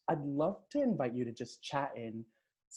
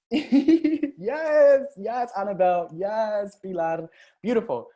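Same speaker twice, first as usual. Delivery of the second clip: laughing yes; 6 semitones higher, 8 dB louder, much slower than usual. Real, not acted. Laughing cheer at chat.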